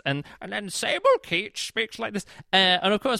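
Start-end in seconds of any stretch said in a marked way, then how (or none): 0.7-2.2 strained, put-on character voice; higher, hammed-up imitation of a narrator's character; Sable Keach impression. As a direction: slip into an odd audiobook character voice. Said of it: "I can do a Sable Keach impression".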